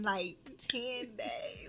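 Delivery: breathy slow whisper of disbelief. Real, not acted. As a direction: whisper it slowly, amazed. Disbelief at turning forty soon.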